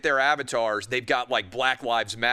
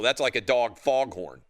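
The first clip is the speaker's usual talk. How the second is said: ordinary talk, nothing marked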